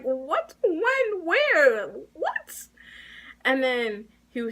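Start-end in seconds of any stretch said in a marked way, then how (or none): 0.0-2.7 high-pitched, disbelieving yelps at modest volume; mock screaming. Student retelling their outburst at a false accusation, incredulous mock scream. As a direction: retell a screamed reaction, pitch leaping on each question. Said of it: "I was screaming because I'm like".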